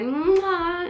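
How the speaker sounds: exaggerated high "mwah" smooch; imitated kiss. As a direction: mimic a big smacking kiss.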